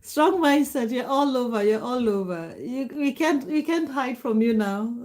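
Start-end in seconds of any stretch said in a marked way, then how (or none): none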